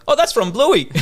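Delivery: high kid voice, lightly imitated; kids recognizing. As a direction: pipe up like a proud schoolkid. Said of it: "a couple of kids are like".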